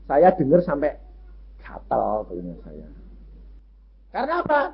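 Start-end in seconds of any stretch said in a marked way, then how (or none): none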